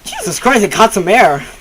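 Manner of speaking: loud, high shaken outburst; shaken exclamation. Real, not acted. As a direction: still jolted from the scare. Right after the scare.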